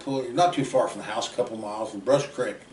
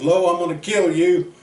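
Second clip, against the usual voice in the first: loud, higher, furious yell at his friend; angry threat; 6 semitones higher, 9 dB louder than usual. Retold or imitated. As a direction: bellow it after taking a gun butt to the mouth. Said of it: "He goes".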